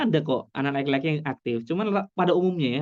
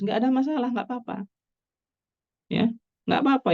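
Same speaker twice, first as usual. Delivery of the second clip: much higher, soothing reassurance; reassuring; 10 semitones higher, faster than usual. Acted. Lecturer calming parents of a boy who colours badly, reassuringly high. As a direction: tell worried parents it's really fine.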